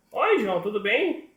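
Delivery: high, loud doll voice; high-pitched puppet hello; little puppet greeting. Acted. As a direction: pipe up as a puppet greeting a friend. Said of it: "a bonequinha vai conversar com o João".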